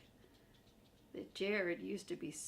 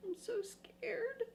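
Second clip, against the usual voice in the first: high, trembling whispered fright; scared whisper; 12 semitones higher, 3 dB quieter, slower than usual. Acted. Grover begging the child not to turn, scared whisper. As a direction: whimper it like a frightened little monster. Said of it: "Grover's pretty scared".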